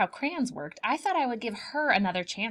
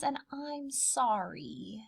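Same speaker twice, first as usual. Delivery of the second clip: slow, whispered apology; a tiny guilty sorry; child whispering an apology to mom; sheepish whisper; 8 dB quieter, much slower than usual. Acted.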